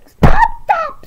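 high, loud exasperated stop, stop; angry shout. Acted. Fed-up doll yelling at the baby. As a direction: bellow it in frustration.

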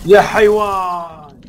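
angry shout. Real, not acted. Insulting an enemy player.

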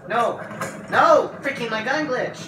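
high, loud angry shout; frustrated yell. Real, not acted. Gun glitches mid fight.

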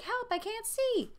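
very high-pitched hammy cry for help; mock panic. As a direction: squeal it like a panicked kid, playfully. Voices boy attacked in closet.

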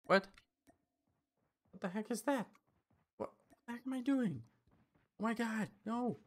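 high-pitched bewildered outburst; baffled dismay. Real, not acted. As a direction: exclaim in real disbelief at your own fumble. Player baffled by own fumbling.

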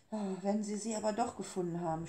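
usual speaking voice

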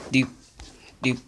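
ordinary delivery

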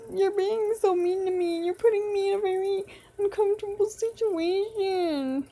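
high-pitched, aggrieved whine; whining complaint. Pretend. Player scolds Springy for being mean, whining.

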